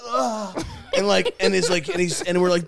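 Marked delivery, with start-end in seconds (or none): 0.1-0.5 imitated cry of someone coming up from water; high-pitched flailing gasp; mock surfacing gasp. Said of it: "Dylan come out and just go".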